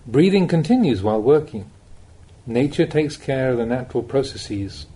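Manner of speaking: ordinary talk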